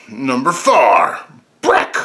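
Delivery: slow build, then a punched title; countdown announcer. Pretend. Host revealing his fourth pick, drawn-out announcer call. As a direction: draw out the number, hit the title hard.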